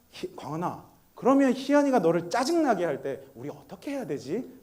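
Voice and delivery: softly spoken, coaxing question; gentle father. Retold or imitated. Father asking his son about baby brother, gentle and hushed.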